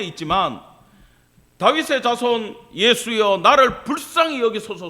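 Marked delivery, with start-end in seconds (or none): 1.6-4.9 crying out. Acted. Blind beggar crying out to Jesus over the crowd, shouting. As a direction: call out loudly for mercy. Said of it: "소리를 친 거야".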